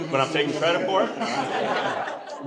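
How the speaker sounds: slow, laughing, sly; tongue-in-cheek. Pretend. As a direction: drag it out with a sly grin. Joke, denies then claims credit.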